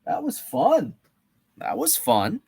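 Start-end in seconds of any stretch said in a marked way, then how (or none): 0.1-0.9 bright pitch jump on fun; gleeful, reacting to a fun reveal; excited. Real, not acted. Host to chat, thrilled at the reveal, excited.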